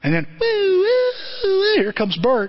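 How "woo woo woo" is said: high wailing woo; mimicking the police siren arriving, high wail; siren imitation.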